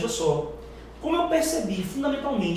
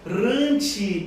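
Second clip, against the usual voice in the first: syllable by syllable. Shown as a demonstration. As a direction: scan Severinorretirante aloud, one syllable at a time. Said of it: "Quantas sílabas poéticas". (escansão pausada, sílaba por sílaba)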